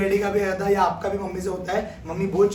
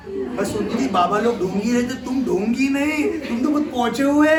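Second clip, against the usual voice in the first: higher, louder gushing praise; thrilled bahu; 6 semitones higher, 4 dB louder than usual. Retold or imitated. Bahu gushing to the baba about his powers, much higher and louder. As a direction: gush over the baba's miracle.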